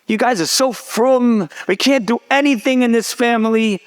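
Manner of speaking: put-on high-pitched exasperated teen complaint; acted-out frustration, pushed up high; frustrated. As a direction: imitate a fed-up daughter whining to her parents. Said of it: "is very frustrated".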